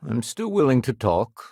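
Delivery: usual speaking voice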